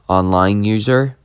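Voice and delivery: usual speaking voice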